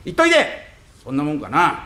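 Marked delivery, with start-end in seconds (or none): 0.1-0.4 high urging. Acted. Wife urging husband.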